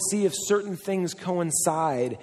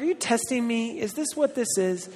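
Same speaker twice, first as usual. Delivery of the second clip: higher, wary put-on voice; mock suspicion; 7 semitones higher than usual. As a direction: play it as suspecting a trap. Suspicious of wife's question.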